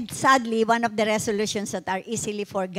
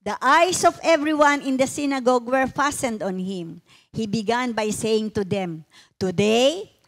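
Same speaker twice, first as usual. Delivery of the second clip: raised, ringing Bible reading to the congregation; scripture reading; 3 semitones higher, 4 dB louder than usual. Acted. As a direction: read the passage like a proclamation, slowing on key lines. Pastor reads Luke passage aloud.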